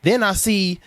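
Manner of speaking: ordinary delivery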